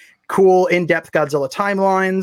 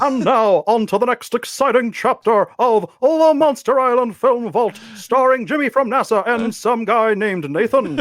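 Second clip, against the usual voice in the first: over-the-top serial announcer, pitched up; announcer guy; 5 semitones higher than usual. Pretend. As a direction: announce the next exciting chapter with flair. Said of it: "I don't get to use announcer guy that much".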